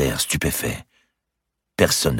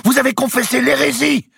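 very high, loud shout; furious accusation; 6 dB louder than usual. A narrator's reading. Archbishop presses the grand master publicly, furious accusation. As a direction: hurl the charge out.

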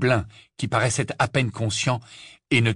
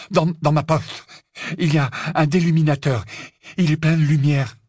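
raised, loud, stumbling urgency; agitated Ron; 7 semitones higher, 5 dB louder than usual. A narrator's reading. Ron telling Luna about the light, agitated urgency. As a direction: push the information out urgently.

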